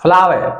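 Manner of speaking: फ्लावर as 'flaa-ve', no R; British R-dropping. Shown as a demonstration. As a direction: drop the final R in फ्लावर. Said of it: "वो रे को खा जाते हैं".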